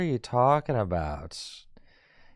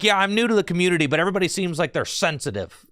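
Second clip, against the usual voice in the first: mock newcomer; 6 semitones higher than usual. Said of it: "you come to the chat and you're like".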